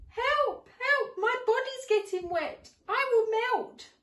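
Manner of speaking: high, loud frightened wail; panicked cry for help. Acted. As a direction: cry out, scared of melting. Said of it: "Soon the gingerbread man said".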